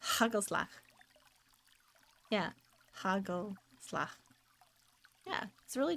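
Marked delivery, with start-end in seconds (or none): 0.0-0.7 Hagelslag, g breathed like h; Dutch pronunciation. Shown as a demonstration. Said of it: "the Dutch G has, like, a h sound". 3.0-4.1 Hagelslag slow and soft, throaty g; Dutch pronunciation. Shown as a demonstration. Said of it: "the Dutch G has, like, a h sound".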